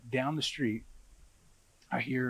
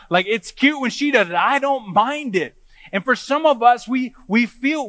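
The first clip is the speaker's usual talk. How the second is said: raised, higher-pitched preaching voice; emphatic preaching; 9 semitones higher, 8 dB louder than usual. Real, not acted. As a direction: drive each phrase home to the congregation.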